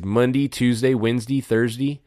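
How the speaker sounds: Monday, Tuesday, Wednesday, Thursday with -day said as -dee; Louisville Monday. Shown as a demonstration. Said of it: "the way he says day".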